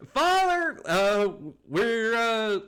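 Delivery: high, loud hesitant call. Acted. Daughter calls father from inside, high loud halting call. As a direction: play a girl hollering from indoors.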